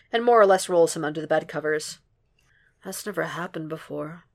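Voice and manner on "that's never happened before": softer, half-asleep mumble; Will drifting off, softly mumbling; sleepy mumble. A narrator's reading. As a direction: mumble it as sleep takes over. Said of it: "Will mumbles, half asleep already".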